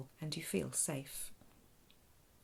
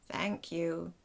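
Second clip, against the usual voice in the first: buzzy alien through a speaker; creaky translated thanks; buzzing robot alien; 3 dB louder than usual. Acted. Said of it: "the captain buzzes".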